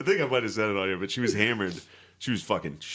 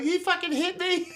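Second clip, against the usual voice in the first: put-on drunk sister wailing. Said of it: "So she was like".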